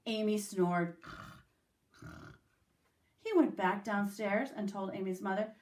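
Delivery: ordinary delivery, nothing marked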